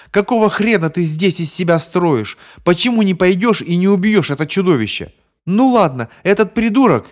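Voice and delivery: high, loud furious shouts; furious yelling. Acted. Bonnie screams at Paul to kill the monster, furious. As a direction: rage at Paul.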